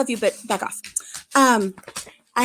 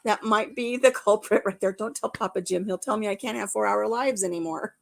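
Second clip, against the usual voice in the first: ordinary talk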